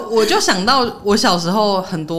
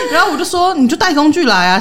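laughing; 8 semitones higher, 4 dB louder than usual. Real, not acted. Laughing retelling the dare.

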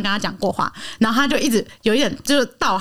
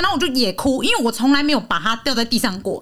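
ordinary delivery

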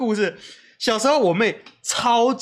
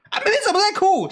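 higher, startled mother's voice; mock mom alarmed; 11 semitones higher than usual. Mom rushing in hearing her daughter cry, mock alarm. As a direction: burst in like a worried mom. Mom bursts in on crying sister.

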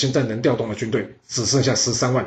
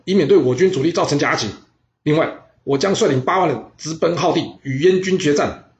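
high, loud battle orders; commanding orders; 5 semitones higher, 4 dB louder than usual. Acted. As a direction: bark out the plan to his officer. General issues battle orders.

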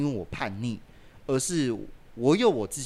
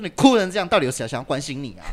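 retold yelling; 3 semitones higher, 9 dB louder, faster than usual. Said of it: "我就说".

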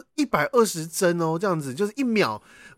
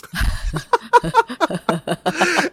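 long, higher real laugh; burst of laughter; 9 semitones higher than usual. Real, not acted. Hearing her friend found a dream dictionary, laughing hard. Reacting to dream interpretation site.

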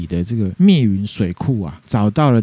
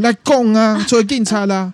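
put-on much higher, taunting young man; mock challenge; 11 semitones higher than usual. Young man taunts the old lady off the bus, much higher mock taunt. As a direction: goad her, pitched way up.

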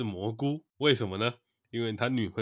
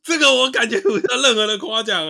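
mock complaint; 10 semitones higher, 8 dB louder than usual.

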